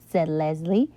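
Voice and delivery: usual speaking voice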